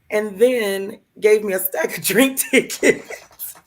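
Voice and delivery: slow, laughing through the words. Real, not acted. Woman telling listeners about the gift, laughing. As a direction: let the laugh break in on the last words.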